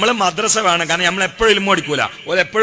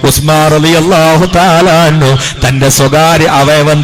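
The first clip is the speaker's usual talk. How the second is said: loud, slow, intoned sermon; fervent preaching; 12 dB louder, slower than usual. Acted. Preacher telling of the Prophet's companions, loud slow fervent. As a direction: build the story with solemn force. Sermon story at full force.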